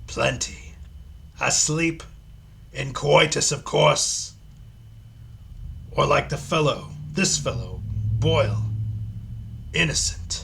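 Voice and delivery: a hint of Pinhead. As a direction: give it cold, amused menace. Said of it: "my Pinhead voice going here".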